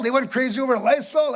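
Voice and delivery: ordinary talk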